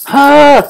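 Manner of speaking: high, loud mock shriek; imitating his son screaming at a jumping frog; kid's startled scream. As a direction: shriek like a scared toddler. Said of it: "and he's like".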